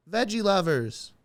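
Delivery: high, drawn-out imitating a heckler calling out; lightly voiced woman yelling; mock heckler yell. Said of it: "just yell out".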